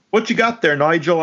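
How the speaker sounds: mock British voice pitched high; hammy imitation of a British thug; British accent. Podcaster mimicking a mutant's line in British. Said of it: "they're British?".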